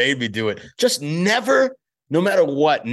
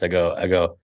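ordinary talk, nothing marked

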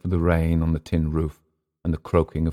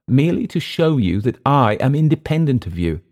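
high, louder declaration; defiant Englishman; 9 semitones higher, 5 dB louder than usual. Acted. Clift answering Emile's question, defiant. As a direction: assert independence in a raised voice.

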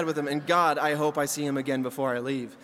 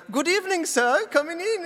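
high, singsong mock German greeting; German Willy Wonka; 12 semitones higher, 3 dB louder than usual. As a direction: announce yourself as a German waiter. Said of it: "And he's like".

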